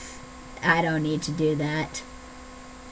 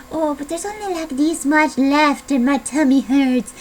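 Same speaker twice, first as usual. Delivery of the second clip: whiny overeater; 8 semitones higher, 5 dB louder than usual. Retold or imitated. Overeater facing the last snap peas, mock whining. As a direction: whine it with a hammed-up bellyache.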